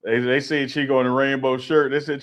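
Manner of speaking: ordinary talk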